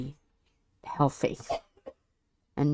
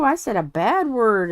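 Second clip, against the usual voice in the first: ordinary talk, nothing marked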